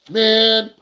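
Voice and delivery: fed up, blurting it out; frustrated groan. Retold or imitated. Worn-out driver gives up on giving rides, exasperated. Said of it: "And you're like".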